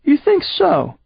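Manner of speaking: high, sneering alien voice; mock him from on high; high mocking villain voice; Seth's sneer. Seth taunting the narrator.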